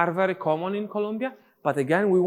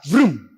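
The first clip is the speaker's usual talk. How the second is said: loud "Vroom" like an engine; vroom sound; 10 dB louder than usual. Shown as a demonstration. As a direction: make a loud engine noise for the move. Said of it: "demonstrating a move".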